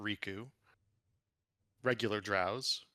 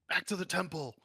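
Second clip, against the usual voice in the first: rally the troops with a raised, high voice; acted yell; 8 semitones higher than usual. Game master as villain ordering followers back, yelling. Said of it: "yells, like".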